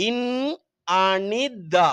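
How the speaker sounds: இன் Anitha very slowly, with the first nasal held and each syllable apart. Shown as a demonstration. Teacher demonstrating pronunciation to pupils, இன் Anitha in slow separate syllables. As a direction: stretch out இன் Anitha piece by piece. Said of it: "உச்சரிப்பு".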